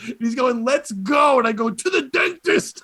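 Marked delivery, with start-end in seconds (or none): 0.6-1.4 mild high mock yell; drunken hype yell, held back a little; imitating a yelling heckler. Imitating drunk front-row guy, mild mock yell. 1.7-2.8 high, punchy comeback; mock-yelled comeback. Comic retorting to a drunk heckler, high mock yell.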